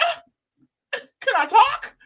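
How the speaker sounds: very high, timid squeak of a voice; a tiny high voice asking permission; squeaky mock Poverty. Friend mocking Poverty's plea to speak, high squeak. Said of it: "And she's like".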